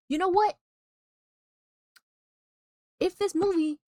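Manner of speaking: loud, high challenge; fed-up outburst, high and forceful; worked-up indignation. Real, not acted. Working up to angry verdict.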